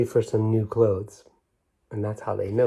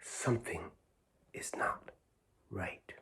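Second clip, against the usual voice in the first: worried hush; 4 semitones lower, 14 dB quieter than usual. A narrator's reading. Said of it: "turned on her light and said".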